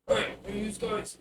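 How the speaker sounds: put-on a scrappy little bugbear kid calling out. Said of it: "you hear a little voice".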